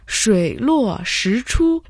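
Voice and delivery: slow Mandarin "шуй луо ши чу", all four tones in place; Chinese pronunciation. Shown as a demonstration. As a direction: join "шуй луо ши чу" slowly, keeping each Chinese tone. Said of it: "переводится как".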